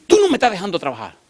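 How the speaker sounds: high, quick mock complaint; retold scolding. Woman complains he is stopping her work, exasperated scolding.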